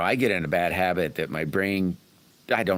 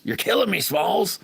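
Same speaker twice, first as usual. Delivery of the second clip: high, louder playful quote; movie line quote; 12 semitones higher, 4 dB louder than usual. Retold or imitated. Teasing a viewer about an unreadable channel name with a movie quote. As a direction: toss off the famous line with a grin.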